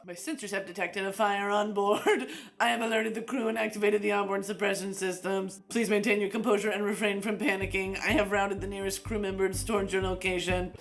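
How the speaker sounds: level, even-pitched computer voice; spaceship AI. Acted. Spaceship AI greets the user, measured AI voice. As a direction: recite it evenly like a ship's computer.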